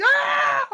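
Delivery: very high, loud shriek; mock scream. Customer being grabbed and thrown out, high mock shriek. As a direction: shriek as if flung out the door.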